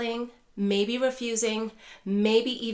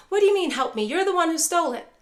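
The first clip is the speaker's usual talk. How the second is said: much higher and louder, accusing; snapping back; 7 semitones higher, 5 dB louder, faster than usual. Retold or imitated. Mom snapping back at caregiver, mock angry. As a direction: throw the accusation back hard. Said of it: "they may snap back".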